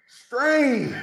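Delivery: high, loud barked coaching shout; Coach Odell impression. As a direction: yell it like a fired-up coach. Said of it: "can you give us a Coach Odell impression".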